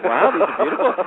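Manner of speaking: impressed wow, retold; excited quote. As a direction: imitate a man getting excited over what he sees. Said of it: "he's actually getting excited".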